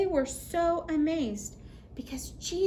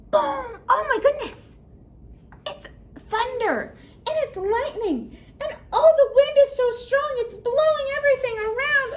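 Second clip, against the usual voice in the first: much higher and louder, breathless with laughter; dramatic storm; 11 semitones higher, 7 dB louder than usual. Acted. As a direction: paint the storm big and wild for kids. Acting out the storm for kids.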